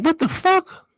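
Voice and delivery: high, startled what the fuck; a sudden jump in pitch of shock; shocked outburst. Retold or imitated. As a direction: squeak it out in disbelief. Grabbed by detectives, shocked.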